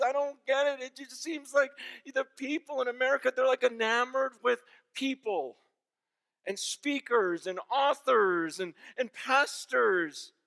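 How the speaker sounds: high, loud, tearful, slowing at the end; retelling a crying pastor. Retold or imitated. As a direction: plead in bewildered tears. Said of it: "He's crying on the phone".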